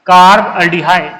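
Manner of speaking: drawn out syllable by syllable, carb aldehyde stretched slowly; slow dictation. Shown as a demonstration. Teacher answering his own question for students, slow careful dictation. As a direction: spell out carb aldehyde slowly so the class can follow. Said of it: "क्या बोलेंगे बेटा?".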